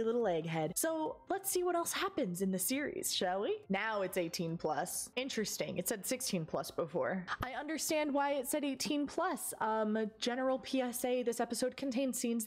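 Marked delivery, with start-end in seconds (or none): none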